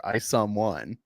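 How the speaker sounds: ordinary talk, nothing marked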